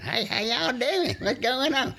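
put-on high, tiny child's greeting; high-pitched kid from Mississippi; little kid voice. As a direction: squeak it out like a small Mississippi boy. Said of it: "Is that how you sounded?".

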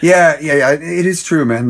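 usual speaking voice

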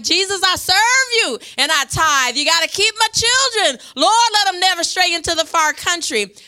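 high, loud, near-crying plea to Jesus; tearful pleading prayer. Real, not acted. Mother praying for her children, high tearful plea. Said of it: "is making me cry because I'm a mother".

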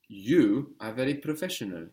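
"You" loud and set apart; stress on YOU. Shown as a demonstration. Teacher prompts student for emphatic form, strong stress on "You". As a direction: hit "You" hard, then go on. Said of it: "wanting to stress the word you".

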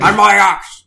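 loud, high gruff dwarf bellow; Gimli voice. Acted. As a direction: boom it out like a hearty dwarf. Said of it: "I'll channel my best Gimli".

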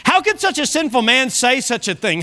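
ordinary talk

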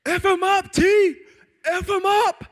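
high, very loud goading yell; egging-on shout. Retold or imitated. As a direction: yell it like a fight instigator. Instigator friend egging him on.

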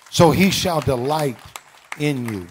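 loud, ringing preacher's cry; shouted proclamation. Real, not acted. Pastor shouts God's delight over the church. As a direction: belt it out to the congregation.

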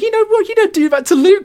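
high, loud mock outrage; indignant friends. Angry partygoers telling off the headbutter. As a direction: protest on Luke's behalf. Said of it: "people were getting, like, pissed".

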